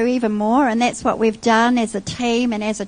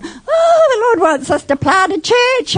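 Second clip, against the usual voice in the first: high, loud laughing cry of joy; excited cry; 11 semitones higher, 3 dB louder than usual. Retold or imitated. Wife retells the drive home, joyful high laughing cry. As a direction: burst out in joyful disbelief.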